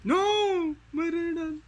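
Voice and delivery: high loud wail of loss; anguished cry. Real, not acted. Gamer losing Raiden, loud despairing no. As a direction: cry out as your hero falls. Character dies in fight.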